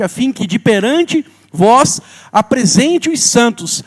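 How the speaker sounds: loud, drawn-out pulpit preaching; full-force preaching. Acted. Preacher proclaiming reconciliation to the congregation, loud and slow. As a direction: proclaim the scripture with rising fervor.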